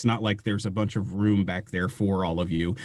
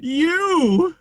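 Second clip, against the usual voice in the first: maniacal laughing shout. Acted. Driver turning on Aurora, maniacal laughing shout. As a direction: shriek it like a madman, then cackle. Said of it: "While he's laughing?".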